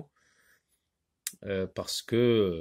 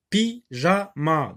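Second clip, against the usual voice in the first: "pyjama" slow and higher, split py, ja, ma; "pyjama" in three distinct, raised beats; syllable by syllable; 5 semitones higher, 3 dB louder, slower than usual. Shown as a demonstration. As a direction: separate each syllable of "pyjama" so pupils hear it. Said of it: "écoutez bien".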